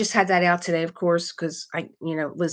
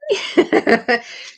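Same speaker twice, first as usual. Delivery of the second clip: laugh; 10 semitones higher, 5 dB louder than usual. Real, not acted. Laughing at start of chat.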